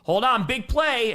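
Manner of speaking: loud, high, drawn-out call; excited interruption. Acted. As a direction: call it out loudly like a big moment is coming. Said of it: "it's just like".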